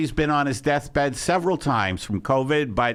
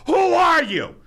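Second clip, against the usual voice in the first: sudden high yell at a stranger; shouting at the window; 11 semitones higher, 4 dB louder than usual. Retold or imitated. Owner yelling at a passerby through the window. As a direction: bark it out at the passerby. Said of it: "at the window going".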